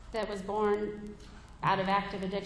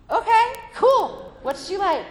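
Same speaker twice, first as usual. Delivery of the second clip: much higher, louder and slow, hammed-up cool; exaggerated casual; 11 semitones higher, 11 dB louder than usual. Mother learning the crush is a girl, exaggeratedly casual. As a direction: overplay the chill mom.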